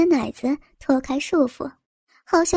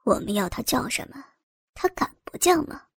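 amused man; 7 dB quieter than usual. A narrator's reading. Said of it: "笑着说道".

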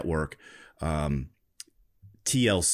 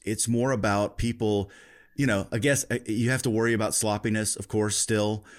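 ordinary talk, nothing marked

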